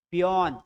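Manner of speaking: पियोन with an open o, "pee-YON"; पियोन, "aw" vowel before the n; taught reading of peon. Shown as a demonstration. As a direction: model पियोन as a single falling word. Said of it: "क्या पढ़ेंगे इसको".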